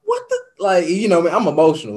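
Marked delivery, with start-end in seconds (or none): 0.0-0.4 squeaky high shout of disbelief, laughing; stunned disbelief. Retold or imitated. Host retelling his reaction to the caller, stunned high squeal. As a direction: blurt it out high, half laughing.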